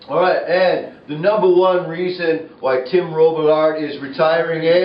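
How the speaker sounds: loud, high top-ten countdown reveal; booming countdown announcer voice; big announcement. Acted. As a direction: announce it like a game show host. Announcing the number one reason.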